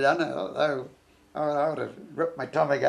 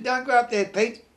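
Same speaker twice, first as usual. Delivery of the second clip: urgent warning; 8 semitones higher, 4 dB louder than usual. Retold or imitated. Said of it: "I said".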